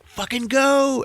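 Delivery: high, loud, stretched shout; hammed-up frantic shout; urgent yell. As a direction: yell at him to take off. Jyn yelling at the pilot.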